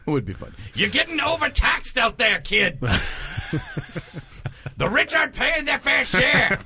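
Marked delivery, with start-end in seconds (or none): 0.8-2.8 put-on much higher shouted old politician; Bernie Sanders impression. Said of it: "debate between the Penguin and Bernie Sanders". 4.8-6.6 put-on much higher shouted old politician; Bernie Sanders impression. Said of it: "debate between the Penguin and Bernie Sanders".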